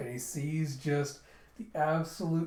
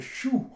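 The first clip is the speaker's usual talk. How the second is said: higher-pitched rising "shoo"; imitated whoosh; 10 semitones higher, 5 dB louder than usual. Flare launching into the sky, rising whoosh. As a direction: mimic a firework taking off.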